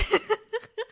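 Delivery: high loud laugh; burst of laughter. Real, not acted. Laughing after the shout.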